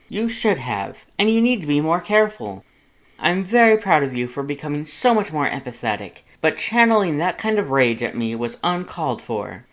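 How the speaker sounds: bold, booming Papyrus voice; loud, grand Papyrus. A narrator's reading. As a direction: proclaim it like a self-important hero.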